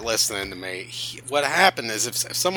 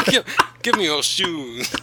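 higher mock Scottish demand; broad Scottish accent; 5 semitones higher than usual. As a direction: bark it in mock Scots. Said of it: "thick Scottish accent".

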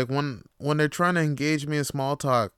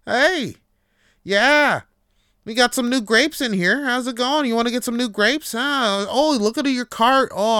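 higher, louder cheery clerk; imitating the grocery clerk chatting him up, higher and louder; chatty grocery clerk; 9 semitones higher, 5 dB louder than usual. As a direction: play the overfriendly grocery guy. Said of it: "he's like".

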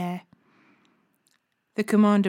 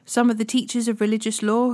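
usual speaking voice

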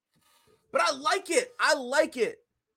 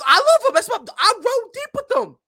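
excited; 14 semitones higher, 8 dB louder than usual. Real, not acted. Excited about wrestlers.

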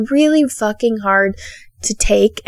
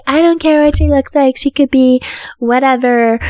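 mocking him; 4 semitones higher than usual. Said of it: "don't be like".

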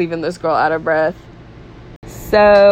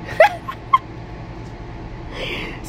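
breathy laugh; 7 dB quieter than usual. Real, not acted. Host laughs at co-host's joke.

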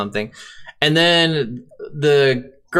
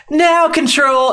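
urgent call; 12 semitones higher, 3 dB louder, slower than usual. Retold or imitated. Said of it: "she'd be like".

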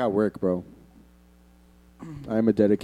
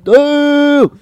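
loud, high-pitched "no" in protest; big scandalized shout; outraged cry; 10 dB louder than usual. Real, not acted. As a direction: yell it out in mock horror. Outcry at a crude joke.

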